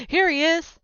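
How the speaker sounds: high, loud triumphant cry; excited find. Acted. Friend pulling back blankets, shouting high and loud. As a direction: shout it high like you found him.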